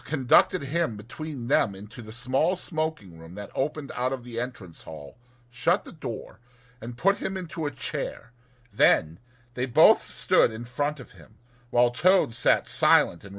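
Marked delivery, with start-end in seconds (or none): none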